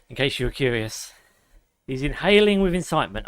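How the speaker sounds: ordinary delivery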